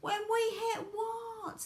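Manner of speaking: high, slow, alarmed question; crying out. A narrator's reading. Everyone cries out at Wonka's plan, high and alarmed. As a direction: draw the question out in a high call. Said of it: "they cried".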